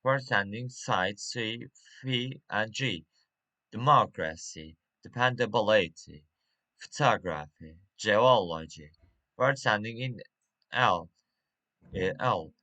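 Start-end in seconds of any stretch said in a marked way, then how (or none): 3.7-8.9 "Democracy", "dependability", "photography", "geology" stressed third syllable from the end; "Democracy, dependability, photography, geology" each with a loud third-last syllable; antepenultimate stress. Shown as a demonstration. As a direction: punch MOC, BIL, TOG and OL in "Democracy, dependability, photography, geology". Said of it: "stress, uh, on antepenultimate".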